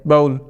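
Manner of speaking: बाउल said with a clear long oh glide; teacher models बाउल, stretching the oh sound; bowl demo. Shown as a demonstration. Teacher demonstrating the vowel of bowl to students, clearly. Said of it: "ये वाली यू sound जोड़ देते हैं".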